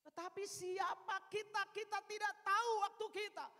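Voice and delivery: loud, high ringing sermon voice; full-force preaching. Acted. Preacher urging the congregation that no one knows their time, at full force. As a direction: drive the point home to the church.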